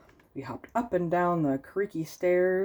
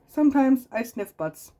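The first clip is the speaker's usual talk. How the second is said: goofy dog voice; 9 semitones higher, 5 dB louder than usual. Acted. Dog confesses.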